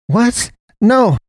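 high, loud shriek. Acted. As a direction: shriek in alarm. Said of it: "Naomi shrieked".

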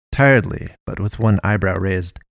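ordinary delivery, nothing marked

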